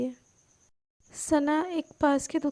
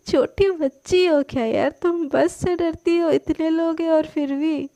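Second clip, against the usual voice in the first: louder, amused teasing; laughing tease; 3 semitones higher, 6 dB louder than usual. A narrator's reading. Sana laughingly teases Palak, louder and amused. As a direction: tease her through laughter. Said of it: "सना तो एकदम हंसने लगती है".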